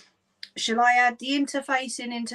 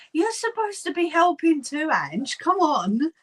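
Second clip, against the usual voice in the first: playful teasing; 7 semitones higher, 3 dB louder than usual. Real, not acted. Teasing a viewer.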